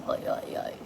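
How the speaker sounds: breathy voiceless croak; mock voiceless croak. Wife imitating husband's voice gone from a cold, voiceless croak. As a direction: rasp it out like a lost voice. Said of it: "आवाज़ नहीं निकल रही".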